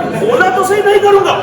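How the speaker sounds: a loud, high burst of mock impatience; exasperated shout. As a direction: snap at the nagging child in mock anger. Guru loses patience with child.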